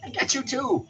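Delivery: playful pet talk. Real, not acted. Owner teasing the dog in playful high baby talk. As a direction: coo it up high like teasing a puppy.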